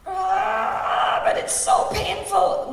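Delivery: high pained yell, voice cracking up; retold scream of pain. Son on the phone mid-tattoo, screaming in pain. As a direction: scream it like the needle hurts. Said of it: "screaming his head off".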